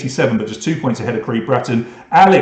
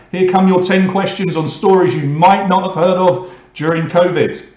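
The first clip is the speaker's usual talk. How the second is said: louder, higher game show announcer voice; loud quiz host; 5 semitones higher, 4 dB louder than usual. Acted. Quiz host hyping the bonus round to players, loud announcer.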